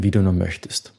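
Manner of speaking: ordinary delivery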